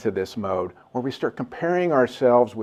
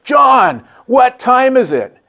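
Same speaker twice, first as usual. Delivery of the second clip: booming high shout across a room; drawn-out, high-pitched public holler; loud voice; 13 semitones higher, 11 dB louder, slower than usual. Retold or imitated. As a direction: imitate a speaker loudly calling someone out. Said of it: "and in a loud voice".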